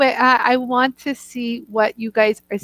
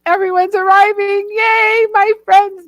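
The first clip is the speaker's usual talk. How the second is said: high, loud giddy cheer; thrilled hostess cheering her guests; giddy excitement; 9 semitones higher, 4 dB louder than usual. Retold or imitated. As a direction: squeal with joy as friends arrive. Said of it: "that happy, giddy feeling".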